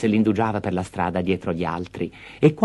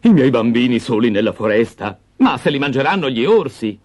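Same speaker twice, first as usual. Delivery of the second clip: louder, alarmed father's cry; father protesting to his wife in horror; horrified father; 3 semitones higher, 5 dB louder than usual. Acted. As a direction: recoil at the idea.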